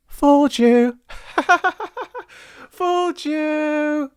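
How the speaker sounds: put-on high, gleeful boy's teasing; laughing taunt. A narrator's reading. Boy laughs at villagers he fooled, high taunt. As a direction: crow over the joke. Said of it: "he laughed".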